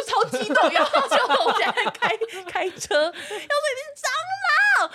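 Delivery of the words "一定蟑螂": slow, much higher, drawn-out cry; panicked cry to her sister while she drives; retold panicked yell. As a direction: stretch it out in a panicked wail. Panicking in the car.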